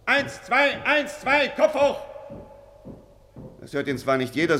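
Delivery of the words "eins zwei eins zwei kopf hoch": high-pitched marching count; drill count. Acted. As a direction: call the count to the squad. Drills troops mid-conversation.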